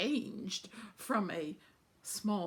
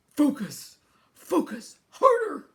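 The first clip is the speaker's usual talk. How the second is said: loud, urgent commands, rising on the last; stern coaching; 5 semitones higher, 10 dB louder than usual. A narrator's reading. Husband telling his wife to focus in labour, stern and loud. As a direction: push her on forcefully, voice raised. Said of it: "her husband sternly counted, ... telling her to".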